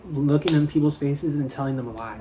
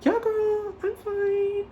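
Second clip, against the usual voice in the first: much higher, forced chipper voice; fake fine; much slower than usual. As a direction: pretend everything's fine, too brightly. Forced reassurance, high voice.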